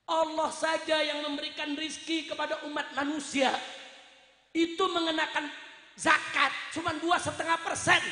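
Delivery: forceful preaching. Real, not acted. Preacher denouncing app companies' cut to congregation, high and forceful. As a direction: hammer the grievance home to the congregation. Preaches indignantly at raised pitch.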